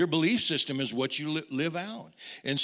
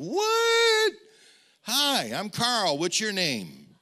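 much higher, bright surprised greeting; cheery hello; 9 semitones higher, 7 dB louder, much slower than usual. Retold or imitated. Preacher retelling meeting Greg, high cheery surprise. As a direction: greet him sunny and high.

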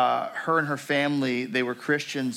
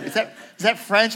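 higher, louder mimicked question; puzzled listeners; 11 semitones higher, 7 dB louder than usual. Congregation questioning the preacher's accent, puzzled and high. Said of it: "and they were like".

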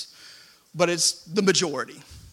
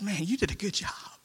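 breathy whisper of a proud onlooker; soft whispered praise; 3 semitones higher, 8 dB quieter than usual. Retold or imitated. Pastor whispers what a stranger might say. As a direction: whisper the compliment like a secret.

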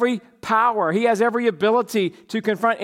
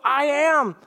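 I am lifted higher; proclaimed; 6 semitones higher than usual. Acted. Preacher proclaiming Jesus' words, lifted higher. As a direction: ring it out.

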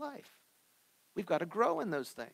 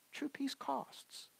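True peace costs, repeated much softer and slowly; soft slow echo; 10 semitones higher, 9 dB quieter, much slower than usual. Acted. Preacher echoing his point, soft and slow. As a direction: let "True peace costs" sink in quietly.

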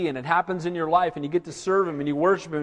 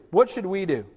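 ordinary delivery

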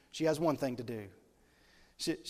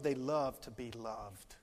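tender; 4 semitones lower, 4 dB quieter, slower than usual. Acted. Tender aside.

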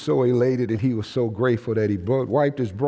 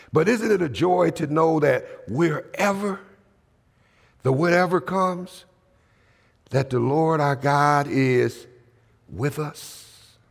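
ordinary talk, nothing marked

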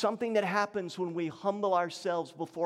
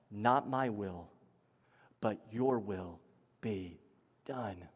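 low, soft, halting prayer; solemn surrender; 11 semitones lower, 6 dB quieter, slower than usual. Retold or imitated. Preacher voices Jesus yielding to God's will, quiet surrender. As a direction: yield word by word, dropping almost to a hush.

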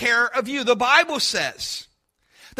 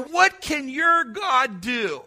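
loud, drawn-out taunt; taunting shout; 5 dB louder than usual. Retold or imitated. The doubter taunts the believer with a slow shout.

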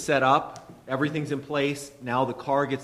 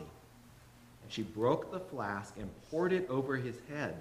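measured, softer Bible reading; scripture reading; 5 dB quieter than usual. Acted. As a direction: read the passage reverently. Reads Mark 14 aloud.

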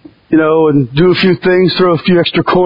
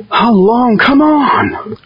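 higher mock impatient sigh-out; impatient whine; 6 semitones higher, much slower than usual. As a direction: act bored and restless in church. Impatient congregant imagined.

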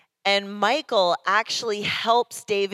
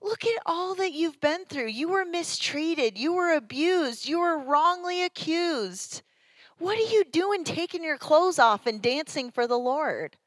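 higher, mocking voice of a bitter wife; hammed-up higher voice of Michal scolding David; resentful wife; 9 semitones higher than usual. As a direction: throw his hardships back at him with scorn. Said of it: "She's like".

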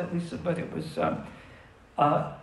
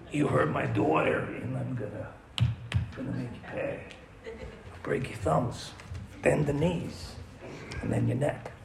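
low, unvoiced menacing threat from a protective father; menacing dad; 5 semitones lower, 5 dB quieter than usual. Bride's father threatening the groom in a low menacing voice. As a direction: growl it out like a dad warning the groom.

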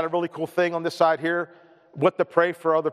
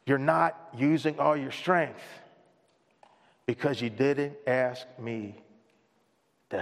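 slow, soft and low, arm round his son; father comforting his frustrated son, tender and slow; tender dad; 3 semitones lower, 5 dB quieter, slower than usual. Retold or imitated. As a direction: gently let the lesson land. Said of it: "puts his arm around him".

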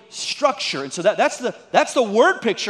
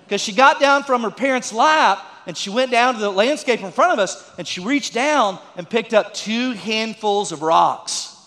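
ordinary delivery

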